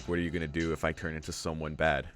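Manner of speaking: normal talking voice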